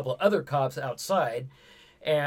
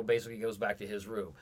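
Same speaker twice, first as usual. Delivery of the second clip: ordinary talk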